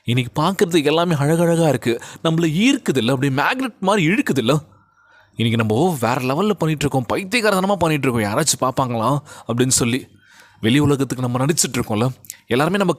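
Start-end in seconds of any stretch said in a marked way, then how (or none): none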